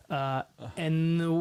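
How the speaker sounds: ordinary delivery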